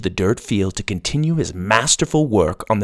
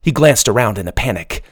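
ordinary delivery, nothing marked

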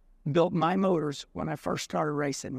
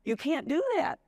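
imitating his family, pitched much higher; pleading family; 8 semitones higher, slower than usual. Racer mimics family telling him off, high mock plea. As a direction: squeak it out like the kids scolding Dad. Family voiced scolding dad.

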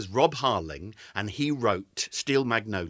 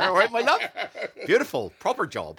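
put-on laughing, higher West Country Plymouth accent; 10 semitones higher, 4 dB louder than usual. Showing her friend how Plymouth folk talk, in a laughing Plymouth accent. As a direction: ham up a Plymouth accent, all right my love. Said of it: "which is even worse accent".

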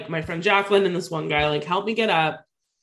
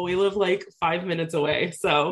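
normal talking voice

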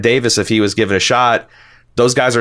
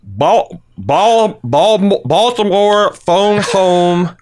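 much higher, louder Baltimore accent with round, drawn-out O in "phone home"; trying out a Baltimore accent for fun; Baltimore accent practice; 11 semitones higher, 3 dB louder, much slower than usual. Shown as a demonstration. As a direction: ham up the Baltimore accent, leaning on the long vowels of "Baltimore" and "phone home". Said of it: "you need to emphasize long vowel sounds".

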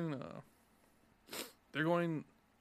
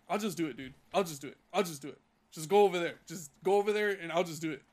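curt, impatient, louder; brushing off a useless coworker, curt and louder; short and curt; 7 dB louder than usual. Retold or imitated. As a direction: snap it off, done with him. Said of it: "I started being really short".

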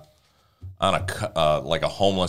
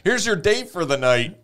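high-pitched, sing-out mock announcement; mock presenting; 10 semitones higher than usual. Bystanders handing the duck to a nerdy guy, mock presenting. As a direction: hand someone over in a higher, put-on voice. Acted quote of movie bystanders.

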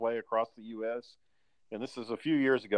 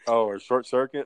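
normal talking voice

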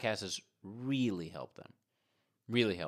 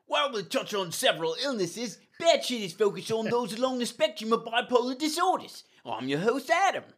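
bad Australian accent; 11 semitones higher than usual. Podcast host opening the show in a bad Australian accent. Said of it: "that really bad, like, Australian English accent".

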